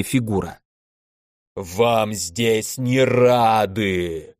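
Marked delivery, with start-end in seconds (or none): 1.5-4.3 very slow monstrous roar, held back in volume; roaring elemental. A narrator's reading. Elder elemental to intruding mages, slow roar. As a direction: draw out every word like a huge fire giant. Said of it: "проревел старший элементаль".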